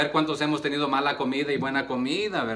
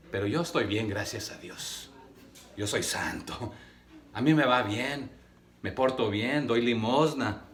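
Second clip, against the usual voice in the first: smug self-righteous; 4 semitones lower, 5 dB quieter than usual. Retold or imitated. Voicing smug self-righteous religious man.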